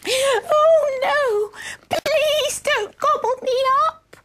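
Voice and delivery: very high, pleading little-goat squeak; tiny goat voice. Acted. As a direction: beg in a teeny, frightened voice. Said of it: "in a tiny voice".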